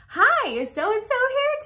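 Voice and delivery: high, slow, sugary hello at the door; sweet polite greeting. Retold or imitated. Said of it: "I knocked on the door and I was like".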